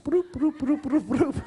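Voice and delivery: high, repeated machine "broop"; comic high-pitched copier sound effect; imitated photocopier noise. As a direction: playfully mimic copies churning out in a high voice.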